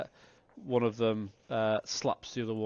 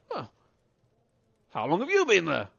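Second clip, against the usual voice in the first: put-on drunk man's surprise; 7 semitones higher, 6 dB louder than usual. Drunk Holt notices the newcomer.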